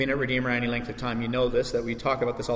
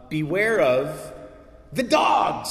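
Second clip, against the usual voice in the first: drawn out, then a high loud burst; hammed-up warning cry, leaping on the last word; mock warning; 4 semitones higher, 3 dB louder, much slower than usual. Preacher acting out Paul's warning about teachers, dramatic cry. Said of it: "It starts with a warning".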